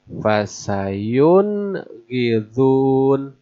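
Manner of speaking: Fasayun gidun very slowly, n distinct before gh; drawn-out recitation; clear nun. Shown as a demonstration. Teacher recites an example very slowly. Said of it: "Nah ini".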